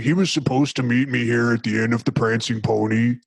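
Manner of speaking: lightly voiced gruff hero asking around the tavern; hammed-up game hero at the inn; a hint of a lost adventurer; mock Doom Guy voice. Doom Guy roleplay, asking for Gandalf.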